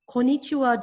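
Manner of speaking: "Konnichiwa" squeezed to ko-ni-chi-wa; four syllables only. Shown as a demonstration. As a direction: drop the first n in "Konnichiwa". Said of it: "does not have five syllables".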